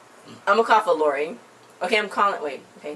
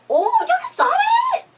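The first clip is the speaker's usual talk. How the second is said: much higher, loud and slow: oh, y'all saw that?; shocked outburst; 4 dB louder, much slower than usual. Real, not acted. Fan shrieking at friend over judge's shade, shocked. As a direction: shriek it in disbelief at the TV moment.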